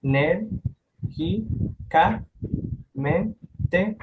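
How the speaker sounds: "ner-gi-ca-mente" said very slowly, each syllable separated; student breaking "ner-gi-ca-mente" into syllables for the class; split into syllables. Shown as a demonstration. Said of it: "dividido en sílabas".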